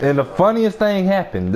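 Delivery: ordinary talk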